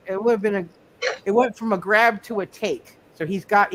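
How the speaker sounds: ordinary talk, nothing marked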